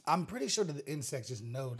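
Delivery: normal talking voice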